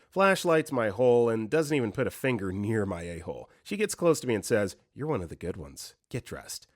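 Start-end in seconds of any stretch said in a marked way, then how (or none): 4.9-6.7 low, softened imitation of a guard; quiet aside. Female guard privately to inmate, quiet retold aside. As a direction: drop the voice as if close by.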